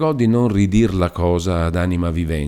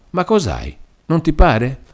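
anxious rising questions, higher pitch; worried mother; 9 semitones higher, 4 dB louder than usual. Acted. Mother asking her sad daughter what is wrong, anxious and higher. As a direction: press her gently but fearfully.

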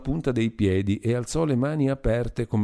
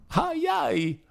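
high moaning cries, much higher; pained wail; 3 dB quieter than usual. A narrator's reading. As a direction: wail like someone struck. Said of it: "esclamò lamentandosi Anita". (Anita si lamenta con gemiti acuti)

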